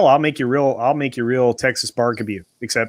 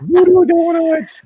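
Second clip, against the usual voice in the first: laughing; 5 dB louder, slower than usual. Real, not acted. Laughing, excited about donuts.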